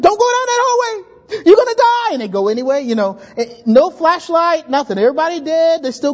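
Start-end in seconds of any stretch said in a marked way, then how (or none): 0.0-2.1 high, loud warning to a movie character; yelling at the screen. Retold or imitated. Moviegoer warning the character, loud yell. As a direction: shout at the doomed hero. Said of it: "you're yelling at the screen".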